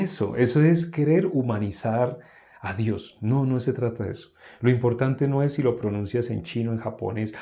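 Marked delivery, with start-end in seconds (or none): none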